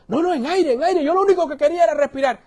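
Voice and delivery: high, hurried protest; acted urgency of someone starved of air; desperate insistence. Young man tells the pastor he wanted air, desperately.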